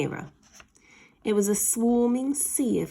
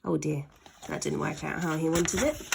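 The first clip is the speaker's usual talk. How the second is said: usual speaking voice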